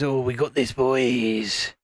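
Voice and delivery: lightly voiced Cockney geezer, low; low-pitched mock Cockney mate; Vaughan. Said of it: "Then he goes".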